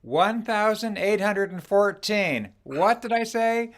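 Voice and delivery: usual speaking voice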